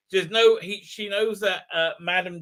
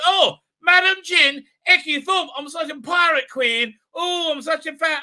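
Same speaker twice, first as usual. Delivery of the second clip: high, loud, hammed-up excitement; mock fangirl gushing; 10 semitones higher, 7 dB louder than usual. Doctor meeting a pirate queen, mock fangirl gushing. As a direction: gush like a starstruck fan, mockingly over the top.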